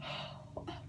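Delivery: breathy, voiceless sigh of disbelief; overwhelmed whisper. Real, not acted. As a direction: whisper it out, winded by grief. Overcome recalling a character's death.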